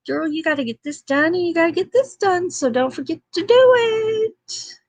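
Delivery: pep talk from my own calendar, quick and rising; sassy pep talk. Pretend. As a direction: cheer yourself on like a bossy best friend. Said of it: "gives me a quick little pep talk".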